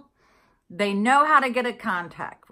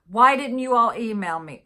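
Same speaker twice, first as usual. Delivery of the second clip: higher, louder retold demand; retold exasperated complaint; 4 semitones higher, 6 dB louder than usual. Customer asking post office staff about her locked box, retold exasperation. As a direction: re-enact a mild confrontation at the counter.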